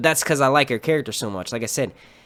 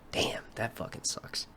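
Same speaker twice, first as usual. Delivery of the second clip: very soft, slow near-whisper; real deflated aside under the breath; dejected whisper; 3 semitones lower, 15 dB quieter, slower than usual. Real, not acted. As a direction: mutter it to yourself, let down. Lost his point, dejected aside.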